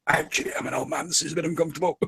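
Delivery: put-on strained, breathless old man being crushed; squeezed by hug. Game master voices the father hugged too tightly, squeezed. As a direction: squeeze the words out with little air. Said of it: "hugging you to the point where".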